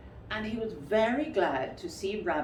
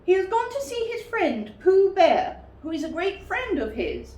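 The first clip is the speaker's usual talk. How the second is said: much higher, louder put-on voice; disguised Rabbit; 13 semitones higher, 8 dB louder than usual. Rabbit fibbing to Pooh, high and loud. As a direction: fib in a silly high voice.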